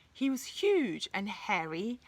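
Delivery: ordinary delivery, nothing marked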